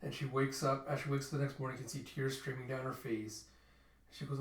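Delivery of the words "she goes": usual speaking voice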